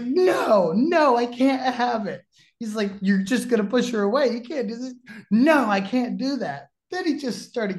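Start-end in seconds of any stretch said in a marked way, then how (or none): 0.0-2.2 higher, louder mock panic, no no; frantic refusal. Bobby refusing the idea of his daughter dating, in a high, loud mock panic. 3.0-4.9 mock lecturing. Lance pressing Bobby, retold. 5.3-6.7 higher, louder mock refusal; a flustered no, pitched up; frantic refusal. Bobby refusing again, in a higher, louder mock panic.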